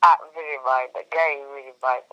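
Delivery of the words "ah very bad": ordinary talk, nothing marked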